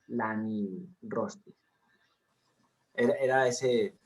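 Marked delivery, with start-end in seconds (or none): 0.1-1.5 Lani Rostris slowly, every i sounded; slow, soft, complete Lani Rostris; slow careful Latin. Shown as a demonstration. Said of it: "todo debe pronunciarse".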